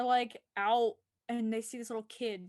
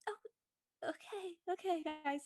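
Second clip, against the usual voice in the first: much higher, much softer awkward backing-off; sheepish Kara; 6 semitones higher, 10 dB quieter than usual. Retold or imitated. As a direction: retreat politely, embarrassed. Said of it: "Kara's like".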